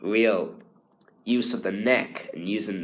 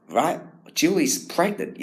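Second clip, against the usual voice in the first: enthusiastic; 8 semitones higher than usual. Retold or imitated. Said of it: "really fulfilled with enthusiasm and thought".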